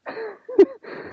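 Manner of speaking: high burst of laughter; real high, amused laugh. Real, not acted. Storyteller reacts to the tour starting Tuesday, laughing.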